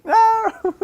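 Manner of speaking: very high, loud cry of denial; laughing protest. Real, not acted. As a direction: wail it in comic protest. Mock protest at co-host's guarantee.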